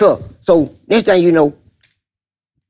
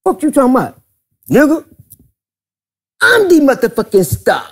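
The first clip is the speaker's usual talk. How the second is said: higher, heated mock rant; mock cussing out; 9 semitones higher than usual. Dirty South cussing out Teddy Carpenter, in a higher heated mock rant. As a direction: go off on him, all attitude.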